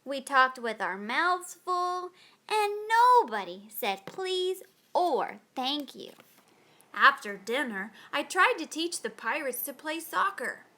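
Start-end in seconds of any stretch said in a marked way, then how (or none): none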